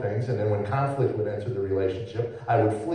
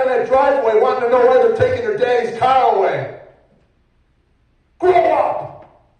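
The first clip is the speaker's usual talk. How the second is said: loud, much higher reproach; wife scolding; 10 semitones higher, 5 dB louder than usual. Retold or imitated. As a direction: scold him about the car, loud and high. Wife scolds him about the car.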